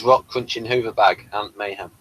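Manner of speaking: ordinary talk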